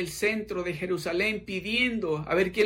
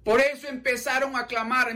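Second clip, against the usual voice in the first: ordinary talk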